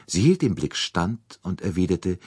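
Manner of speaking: ordinary delivery, nothing marked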